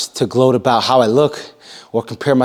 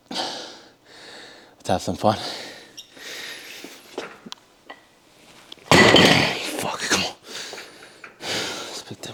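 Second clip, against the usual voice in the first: muttering under breath; 4 semitones lower, 9 dB quieter, much slower than usual. Real, not acted. Psyching up before heavy set.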